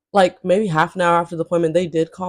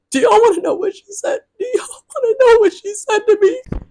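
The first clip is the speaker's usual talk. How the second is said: exaggerated whiny disbelief; 13 semitones higher, 5 dB louder than usual. Real, not acted. Building up the punchline to viewers.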